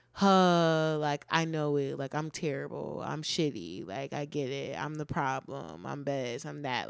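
low, softened self-pitying grumble; negative self-talk. Retold or imitated. Mimicking a person who dodges tough talks, mock self-pitying. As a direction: imitate someone putting themselves down, low and quiet. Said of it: "negative talk".

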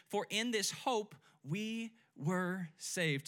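each word of "For in this hope we were saved" slow and weighted; preacher modelling a verse for the church to repeat; slow scripture emphasis. Shown as a demonstration. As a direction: lay out the verse slowly, word by word. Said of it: "Say that with me".

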